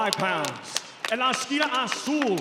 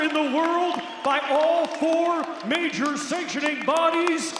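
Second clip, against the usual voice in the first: booming arena call, higher and louder; lucha libre announcer; 6 semitones higher, 4 dB louder than usual. Acted. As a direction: drag out the fighter's name for the crowd. Main fighter introduction.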